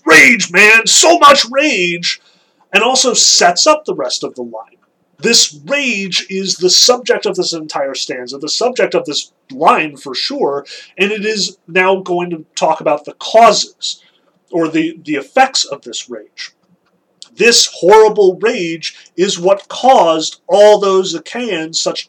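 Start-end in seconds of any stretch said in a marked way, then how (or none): 0.0-2.2 loud, high burst of mock rage; hammed-up angry shout; emphatic outburst. 17.3-21.1 slow and louder, leaning on all; grave emphatic stress. Shown as a demonstration. Stressing the horror of rage.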